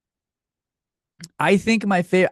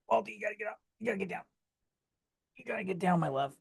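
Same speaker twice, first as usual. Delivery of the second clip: higher and much softer, cooing at the dog; pet talk; 8 semitones higher, 11 dB quieter, much faster than usual. Real, not acted. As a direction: sweet-talk the dog down gently. Coaxing the dog off.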